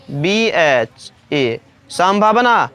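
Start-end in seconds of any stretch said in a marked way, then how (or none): 0.1-1.6 slow spelling. Shown as a demonstration. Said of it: "फिर भ है तो".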